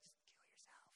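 unvoiced whisper; whispered bad advice. Friends' awful advice, whispered. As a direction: breathe it out as a dark aside. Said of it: "But it's like".

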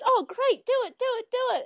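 high, eager cheering as Mimi; excited girl. Retold or imitated. Young girl urging her friend, in a high eager cheer. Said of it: "And then Mimi goes".

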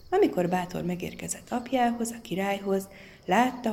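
ordinary delivery

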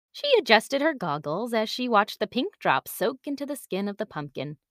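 usual speaking voice

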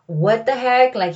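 ordinary delivery

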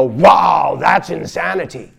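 loud mocking exclaim; mock shocked onlooker. Imagined gawker reacting to the world's madness, mock shout. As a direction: shout it like a gawking bystander. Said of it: "for you to go".

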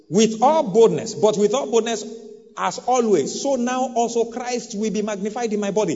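loud, rising sermon cry, high and strong; preaching at full force. Acted. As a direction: preach it out with full fire to the congregation.

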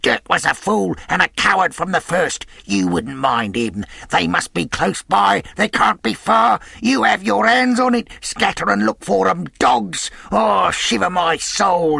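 high, loud tirade; furious, desperate shouting; raging cry. A narrator's reading. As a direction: berate cowardly men, building in frustration. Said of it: "he cried".

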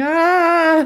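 high, loud mock cry; hammy adult scream. Cut to the grown-up self, loud hammy scream. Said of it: "cuts to the adult one going".

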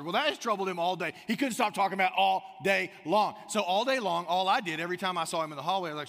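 normal talking voice

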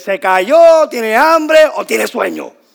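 high, very loud calling out; parent's loud shout. Acted. Parent guessing why the child screams, shouted.

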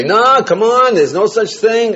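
mock dismissive retort. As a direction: brush him off in a heated argument. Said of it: "Don't say".

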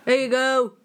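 loud, high mock delivery call; mock driver. Partner mocks the driver's call at the door, loud. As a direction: hand it over like a loud driver. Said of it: "imitating driver handing food".

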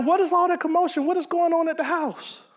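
high, puzzled call from outside the party; jealous older brother asking what is going on; puzzled older son. Acted. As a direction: ask what all the noise is, bewildered. Older son hears party, asks.

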